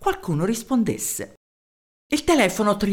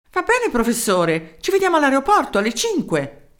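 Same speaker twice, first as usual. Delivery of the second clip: a higher, eager mayor's voice; 7 semitones higher, 3 dB louder than usual. Acted. Mayor introducing himself to the professor, eager. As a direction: introduce yourself keenly down the phone.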